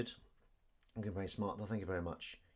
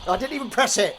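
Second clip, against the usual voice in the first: high, loud exclamation; startled protest; 14 semitones higher, 15 dB louder than usual. Real, not acted. Streamer whose gadget went off unpressed, startled loud protest. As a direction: blurt it out in high-pitched disbelief. Device goes off by itself.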